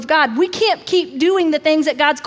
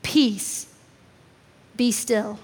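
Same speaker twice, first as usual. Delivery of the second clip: a lower, softer command to calm; soft, hushed Peace, be still; 6 semitones lower, 6 dB quieter, much slower than usual. Acted. Pastor speaking Jesus' words over the congregation, softly. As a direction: speak it gently and low over them. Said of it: "hear him speak that over our lives".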